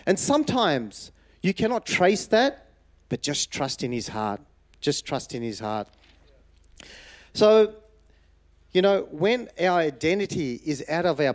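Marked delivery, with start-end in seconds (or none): 3.1-5.9 soft sincere plea. Real, not acted. Preacher's soft repeated exhortation.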